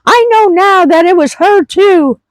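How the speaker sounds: imitating her friend's mother. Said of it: "And she says".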